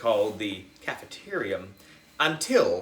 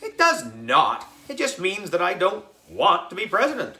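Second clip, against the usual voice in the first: indignant protest; 8 semitones higher, 4 dB louder than usual. A narrator's reading. AJ protests being teased.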